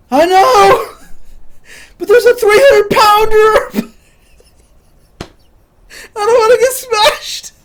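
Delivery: high, loud, desperate reply; panicked yell. Retold or imitated. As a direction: shout it while running away scared. Scared self replying while fleeing.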